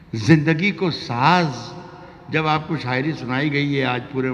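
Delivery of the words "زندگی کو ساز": poetic recitation. Acted. Poet recites verse.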